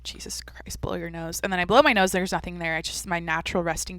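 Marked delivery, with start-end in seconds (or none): 0.0-1.4 breathy unvoiced whisper; whispered reaction. Hearing own nasal voice on the podcast, whispered dismay. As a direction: mutter it under your breath, half-appalled. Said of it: "I heard my voice once on the podcast. I was like".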